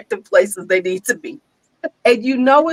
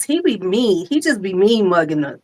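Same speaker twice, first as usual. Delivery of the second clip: usual speaking voice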